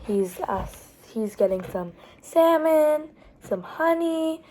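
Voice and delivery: usual speaking voice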